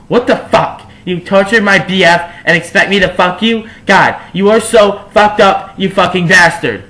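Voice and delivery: loud, high furious outburst; raging teen heroine; angry. A narrator's reading. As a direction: spit the curses out in rage. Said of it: "I said angrily".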